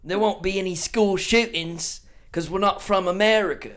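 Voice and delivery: mock-English jibe at Americans; hammed-up English; English accent. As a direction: taunt in a put-on English accent. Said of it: "He knew pub was English".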